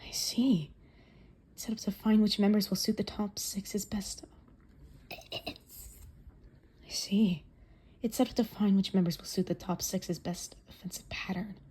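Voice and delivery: breathy whispered inner thoughts; whispering. Acted. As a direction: whisper it to yourself.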